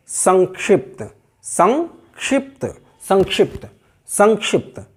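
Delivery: "संक्षिप्त" repeated as sank-shipt, ng before ksh; careful correct demo. Shown as a demonstration. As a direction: model "संक्षिप्त" deliberately, ng and ksh distinct. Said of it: "सटीक उच्चारण क्या है". ("संक्षिप्त" में ङ का साफ़ उच्चारण)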